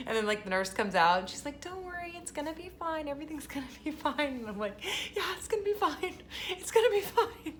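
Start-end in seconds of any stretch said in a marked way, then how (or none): 1.6-4.3 high, soft soothing nurse voice; softly pitched-up imitation of reassuring; imitated reassurance. Nurse comforting the crying mother. 5.2-7.5 high, squeaky mock-tearful voice; hammed-up choked-up agreement; holding back tears. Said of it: "you're, like, trying not to cry".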